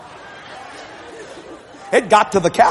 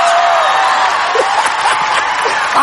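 high laugh; 4 dB louder than usual. Real, not acted. Preacher laughs loudly.

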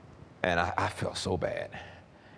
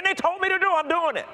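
much higher and louder, fast, desperate cry; desperate outburst; 6 dB louder than usual. Retold or imitated. Grieving man to God, high loud desperate outburst. Retelling desperate fasting and prayer.

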